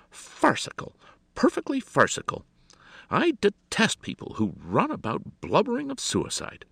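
very high, disdainful dismissal; affected lady's contempt; scornful society hostess. A narrator's reading. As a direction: dismiss it as absurd, pitched right up.

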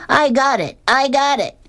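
very high-pitched fielder's call. A narrator's reading. Girl running with her mitt, calling out. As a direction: sing out high without real volume.

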